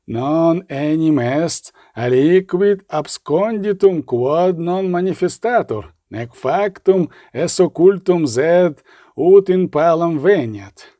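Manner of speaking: slow, measured Latin recitation; solemn scripture quotation. A narrator's reading. Wallcreeper reminding the bishop of the Gospel, solemn slow quotation. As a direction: intone the Latin verse slowly and deliberately.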